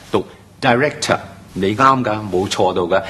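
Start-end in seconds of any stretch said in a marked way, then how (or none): 0.6-1.2 director開頭讀成dai，好似「大」音; 示範director，頭一音節讀雙元音ai; dai讀法. Shown as a demonstration. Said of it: "加個I音上去嘅". (將director讀成dai-rector)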